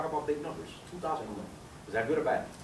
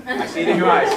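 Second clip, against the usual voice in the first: louder, laughing through the words; laughing hype; 4 semitones higher, 9 dB louder than usual. Real, not acted. Presenter teases a guest who is ready to join, laughing loudly. Cheering on a new recruit.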